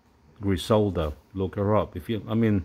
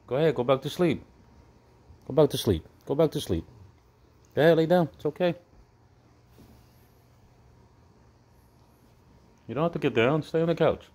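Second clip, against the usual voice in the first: soothing; 6 semitones higher than usual. Real, not acted. Soothing dog to sleep.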